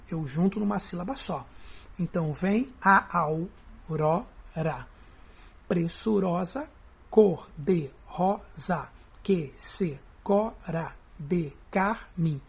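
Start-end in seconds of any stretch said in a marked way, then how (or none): 2.3-12.4 "vem a aurora" and the rest very slowly, each syllable apart. Shown as a demonstration. Teacher splitting the stanza for students, slow syllable-by-syllable reading. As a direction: break every word of "cor de rosa" and "carmim" into separate beats. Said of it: "fui separando as sílabas normalmente".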